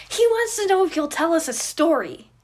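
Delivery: put-on high, loud kid cutting in; interrupting kid. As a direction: blurt it out over the other boy. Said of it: "Remus interrupts".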